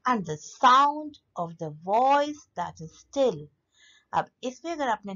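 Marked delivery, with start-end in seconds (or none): 0.1-3.5 slow reading with "sound", "voice" and "still" stressed; anapest metre. Shown as a demonstration. Teacher reading the example line, slowly stressing "sound", "voice", "still". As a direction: stretch the strong beats "sound", "voice", "still". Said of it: "अब इस line को देखिए".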